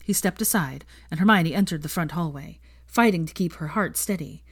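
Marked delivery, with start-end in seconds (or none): none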